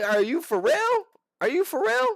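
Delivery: high, loud starstruck incredulity; fangirl disbelief. Retold or imitated. Fan raves about Justin Gaethje, high fangirl disbelief. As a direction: marvel at your favourite fighter. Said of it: "I legitimately fangirled".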